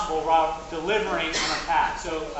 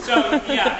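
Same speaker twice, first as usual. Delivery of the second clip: laughing; 10 semitones higher, 6 dB louder than usual. Real, not acted. Laughing after a joke.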